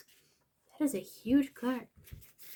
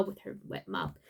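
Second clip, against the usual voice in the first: ordinary delivery, nothing marked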